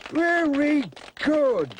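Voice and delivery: put-on slow, drawn-out old man praise; old man calling. Old Mister Drury praising the children, slow. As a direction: call out slowly like an old man. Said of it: "calls old Mister Drury".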